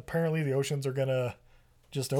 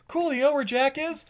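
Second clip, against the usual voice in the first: much higher, bubbly gushing voice; super perky; 11 semitones higher than usual. Retold or imitated. Claire telling the captain her good news, super perky. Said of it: "she's super perky".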